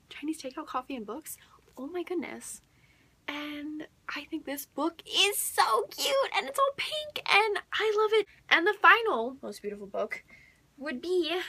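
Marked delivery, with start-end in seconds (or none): 5.1-8.3 very high and bright, squealing with delight; gushing squeal. Real, not acted. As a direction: gush over it like a fan. Gushing over pink book.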